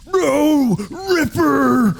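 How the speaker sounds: high, loud goblin scream of grief; anguished goblin cry. Acted. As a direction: wail for the fallen wolf. Goblin grieves his dead wolf.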